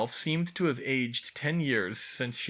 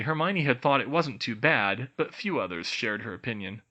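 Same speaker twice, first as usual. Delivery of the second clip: usual speaking voice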